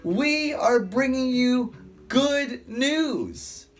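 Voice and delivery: high, slow and pleading apostle voice; slow, lifted plea to the crowd; Paul preaching. Acted. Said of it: "Paul shouting".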